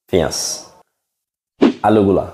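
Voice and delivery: usual speaking voice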